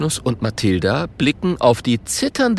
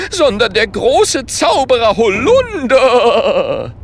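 high, loud, drawn-out shout; indignant proclamation; 11 semitones higher, 6 dB louder, slower than usual. A narrator's reading. Holunder declaring himself a great wizard, indignant shout. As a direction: proclaim it, roaring out the name. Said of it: "sagt empört".

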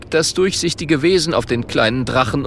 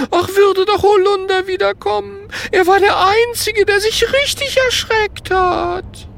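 very high, wailing ghost lament; tearful longing; 3 dB louder than usual. A narrator's reading. Ghost missing the one it could scare, tearful wail. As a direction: whine it out through tears. Weeping ghost longs for Holunder.

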